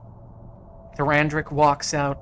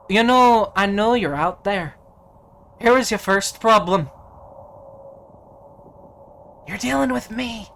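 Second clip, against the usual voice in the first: much higher, loud challenge; defiant hero boast; 8 semitones higher, 8 dB louder than usual. Acted. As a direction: call out to the hidden foe. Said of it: "as he says".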